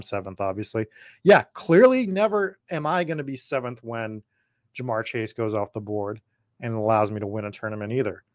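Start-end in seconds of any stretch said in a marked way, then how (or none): none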